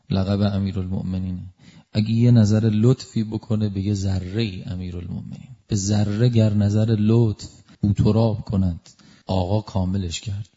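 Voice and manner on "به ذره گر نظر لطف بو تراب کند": slow, measured verse recital; slow recitation of a verse; poem recitation. Acted. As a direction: recite the line slowly, savouring it.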